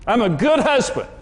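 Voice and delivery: husband shouting his case at his wife in the car; retold yelling. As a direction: yell it back defensively, mildly mocked. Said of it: "I was yelling at Karen".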